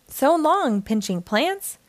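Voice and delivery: usual speaking voice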